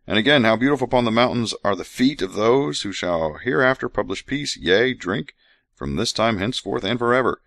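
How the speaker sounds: measured scripture recital; scripture reading. Acted. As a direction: read it like holy writ. Reads scripture aloud.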